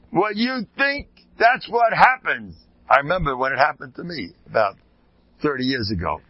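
ordinary talk, nothing marked

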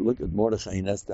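usual speaking voice

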